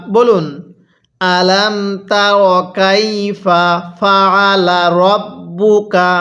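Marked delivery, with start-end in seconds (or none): 1.2-6.1 slow linked "আলাম তার কাইফা ফাআলা রব্বুকা"; joined recitation. Shown as a demonstration. Teacher has learners join words, slow "আলাম তার কাইফা ফাআলা রব্বুকা". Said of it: "আমার সাথে মিলিয়ে পড়ুন".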